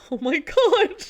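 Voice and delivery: high, loud burst through laughter; laughing oh my God. Real, not acted. Laughing as she remembers the odd customer. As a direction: crack up at the memory.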